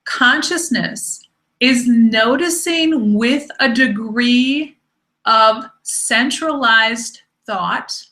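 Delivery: slow, weighted words with space between; deliberate, resonant emphasis. Shown as a demonstration. Teacher emitting the vibration of a definition, slow and deliberate. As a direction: let each word land on the listener. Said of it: "as I really emit the vibration of these words".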